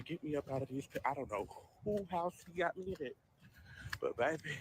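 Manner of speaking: ordinary talk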